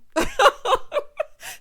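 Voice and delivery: high, loud cackle; real laugh. Real, not acted. Host amused by their own song, cackling loudly.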